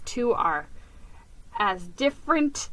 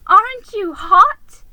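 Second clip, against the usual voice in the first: gasp; 11 semitones higher, 12 dB louder than usual. A narrator's reading. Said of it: "she finally managed to gasp".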